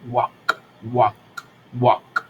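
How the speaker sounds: walk said wak, no l sound; silent L. Shown as a demonstration. Teacher drilling walk for the class, L silent. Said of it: "la L no suena".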